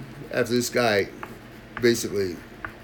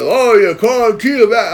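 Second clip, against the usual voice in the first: mock smug stranger; 9 semitones higher, 8 dB louder, slower than usual. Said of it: "He goes".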